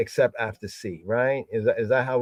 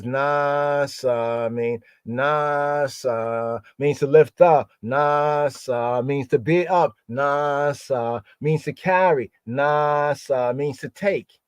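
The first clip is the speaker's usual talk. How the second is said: very slow, "nasa" stretched long each time; measured recitation, lingering on "nasa"; slow teaching; slower than usual. Shown as a demonstration. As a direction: drill each meaning slowly and evenly. Teaching the meanings, drawn-out word.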